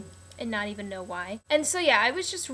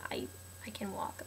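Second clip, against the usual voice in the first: hushed groggy whisper; whispered insistence; 3 semitones lower, 13 dB quieter than usual. Retold or imitated. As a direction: whisper it, still half asleep. Insisting groggily after surgery.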